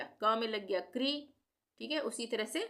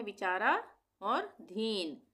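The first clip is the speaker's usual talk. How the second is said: विचारा, then a pause, then a slow धीन; split in two parts; slower than usual. Shown as a demonstration. Teacher splits the word into two parts for pupils. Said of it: "इसको हम दो भागों में कर सकते हैं".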